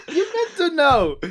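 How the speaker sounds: high, laughing reply. Real, not acted. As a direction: laugh through it.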